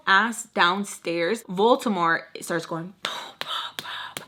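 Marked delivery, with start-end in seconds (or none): 3.0-4.2 soft unvoiced imitation of knocking; mimicking the pounding on the door; whispered knocking. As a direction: breathe the booms as a whisper.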